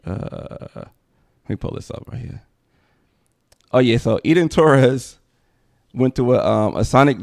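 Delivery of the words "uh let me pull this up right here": soft, half-voiced mutter to himself; low muttering while pulling up a video; muttered aside. Real, not acted. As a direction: mumble it under your breath while looking something up.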